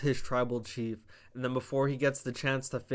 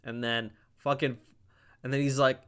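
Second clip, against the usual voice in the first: ordinary delivery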